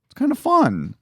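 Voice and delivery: very high squeaky voice. Real, not acted. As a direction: squeak it out playfully. Mock squeaky remark.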